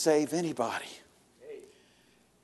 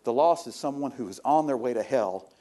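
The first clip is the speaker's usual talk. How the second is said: normal talking voice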